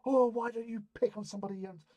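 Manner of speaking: high scolding mum voice; shrill up like a scolding mother; mate's mum. Retold or imitated. Friend's mum telling him off, high mock scold. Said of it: "his mum has come out".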